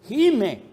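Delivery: "Gime" called out high and loud with a fall; groaning cry. Shown as a demonstration. ("Gime" gritado, alto y fuerte)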